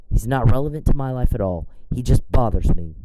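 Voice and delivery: ordinary talk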